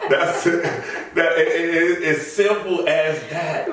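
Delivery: chuckling while he insists it's that simple; laughing. Real, not acted. Guest enjoying his own Nike joke, laughing.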